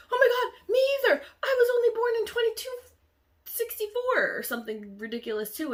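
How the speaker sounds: very high and loud, fast, half laughing half crying; frantic laughing panic. Retold or imitated. Second girl blurting her own birth year, frantic. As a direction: gush out the shock at speed.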